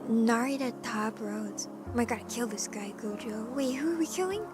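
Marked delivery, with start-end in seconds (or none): none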